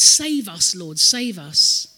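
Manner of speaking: loud, urgent plea; crying out. Acted. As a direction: call out loudly for rescue, slowing at the end. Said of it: "They were crying out".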